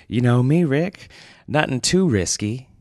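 Winston's voice. Acted. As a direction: tease your colleague lightly.

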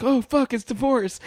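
high startled squeal; mock surprised. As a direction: act shocked at the news. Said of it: "and it's like".

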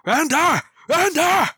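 high bellowed call; bellowing. Acted. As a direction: bellow the name across the distance. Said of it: "take a step back and just bellow".

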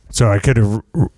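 ordinary talk, nothing marked

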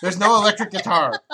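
loud playful yell; high, loud "shut up" at a friend; shouting. Real, not acted. Cut off by a friend's heckle.

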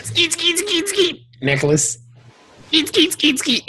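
high squeaky skeet sounds; skeet noises. Retold or imitated. Mock ejaculation sound effect.

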